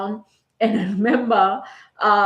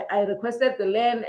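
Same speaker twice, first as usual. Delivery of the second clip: ordinary talk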